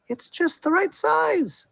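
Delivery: very high slow squeaky mouse; tiny mouse voice. Acted. Missus Mouse pleased with the tiny tree, very high slow mouse voice.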